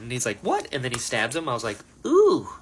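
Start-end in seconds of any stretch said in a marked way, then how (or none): 0.4-0.7 shocked what. Retold or imitated. Pursuer's shocked reply, retold. 2.1-2.5 wincing ooh. Retold or imitated. Own reaction to the stabbing.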